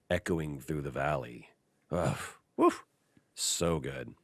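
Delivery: ordinary talk, nothing marked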